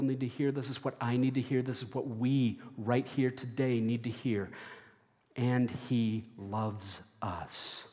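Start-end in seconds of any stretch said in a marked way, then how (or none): 5.4-7.8 slow, tender emphasis. Shown as a demonstration.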